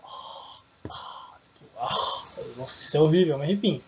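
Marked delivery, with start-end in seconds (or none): 0.0-2.2 breathy voiceless "ah" with no voice at all; just push air out on "ah"; whispered h sound. Shown as a demonstration. Teacher shows students the h sound, whispered breath. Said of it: "tô fazendo aquele som".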